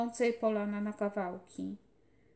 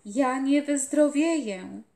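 much louder, higher, slow desperate cry; full-chested defiant shout; shout with all his might; 4 semitones higher, 9 dB louder than usual. A narrator's reading. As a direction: scream it from sick lungs. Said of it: "Z całej piersi ... krzyknął".

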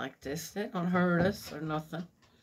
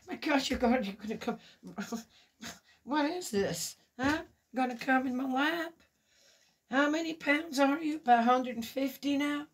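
higher, playful voice for the puppy; talking to a dog; 7 semitones higher than usual. Real, not acted. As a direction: coo at the dog on your lap.

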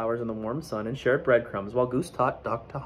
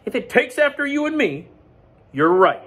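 higher and louder; Goose; 12 semitones higher, 7 dB louder than usual. Acted. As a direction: agree like a proud goose. Goose answers.

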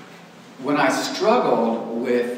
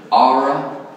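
"Aura" for the letter R in an East Texas accent, louder; 3 semitones higher, 8 dB louder than usual. Real, not acted. Teacher spelling out his link in an East Texas accent. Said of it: "That's my East Texas accent".

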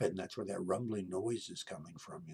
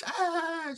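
high, loud giggly squeal; imitated ticklish squeal; 9 dB louder than usual. Imitating niece tickled near her rib cage, squealing. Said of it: "she's laughing and giggling".

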